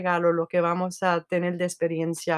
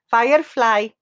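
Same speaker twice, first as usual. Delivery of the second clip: louder firefly in a New York English accent, voicing Siri; Siri's New York accent; 3 semitones higher, 4 dB louder, slower than usual. Host voices Siri's New York accented reply. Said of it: "le pregunté la tercera vez".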